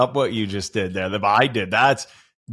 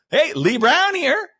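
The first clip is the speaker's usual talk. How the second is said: much higher and slow, a hammed-up cheery phone hello; mock phone greeting; 14 semitones higher, slower than usual. Host mimics the guest's fake grocery-store phone call, hammed up. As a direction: imitate her showy fake call. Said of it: "And she goes".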